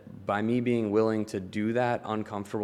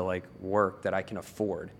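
normal talking voice

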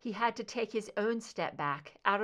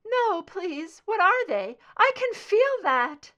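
much higher and louder, pleading in agony; crying out in pain; 12 semitones higher, 12 dB louder, slower than usual. A narrator's reading. Scully on the table, crying out in agony. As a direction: beg them to stop. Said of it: "she cried out".

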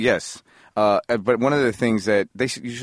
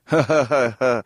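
mock "ha, ha, ha", pitched up; spelt-out pretend laughter; imitated laugh; 3 semitones higher, slower than usual. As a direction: fake a forced laugh at a bad joke. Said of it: "people being like".

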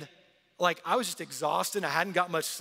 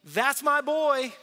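higher, louder and slow, a beaming father; proud voice of God; 8 semitones higher, 10 dB louder, much slower than usual. Acted. God announcing over baptized Jesus, proud and booming. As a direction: call it out from heaven with booming pride. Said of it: "a voice came from heaven saying".

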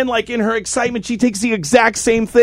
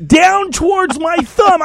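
ordinary delivery

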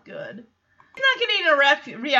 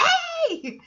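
a yelped high mock protest; Max protesting. Host imitating Max's high indignant hey. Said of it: "Max is like".

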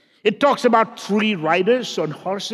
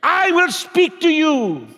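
full-force preaching; 7 semitones higher than usual. Acted. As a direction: preach it out with fire to the crowd. Opening appeal, preaching at full force.

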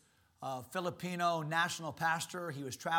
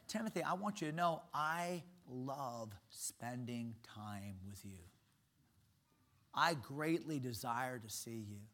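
tender; 4 semitones lower, 6 dB quieter than usual. Retold or imitated. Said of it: "Paul said".

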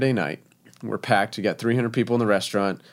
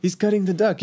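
put-on high French-accented chef voice; 10 semitones higher than usual. Head chef reporting cook to executive chef, French accent. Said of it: "in his French accent".

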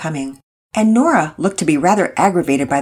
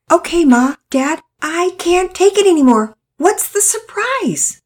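high, loud plea, slowing at the end; can't hold it in any longer; bursting impatience; 13 semitones higher, 5 dB louder, slower than usual. A narrator's reading. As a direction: Todd interrupting dinner to ask his parents. Boy bursting to know surprise.

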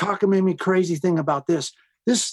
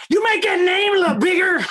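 angry shout; 14 semitones higher than usual. Retold or imitated. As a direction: bark it down the phone. Angry phone call retold.

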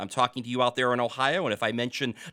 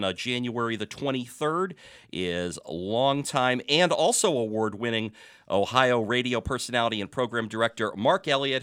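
ordinary delivery

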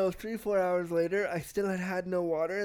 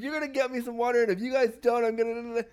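loud, higher yell demanding water; retold yelling; 6 semitones higher, 6 dB louder than usual. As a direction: shout the demand at the orderly, pitched up. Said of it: "So I started yelling at him".

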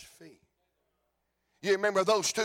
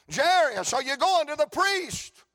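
calling bystander; 6 semitones higher, 3 dB louder than usual. Acted.

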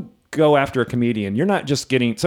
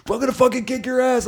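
much higher mock yelled threat; angry surfer's retold threat, mock yell; 13 semitones higher than usual. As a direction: imitate the angry surfer's yelling.

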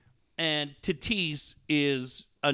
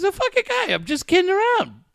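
much higher exasperated woman's voice; imitating his friend; 5 dB louder than usual. Host voicing friend annoyed at her ex, much higher exasperated voice. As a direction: throw it up high, fed up. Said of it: "She goes".

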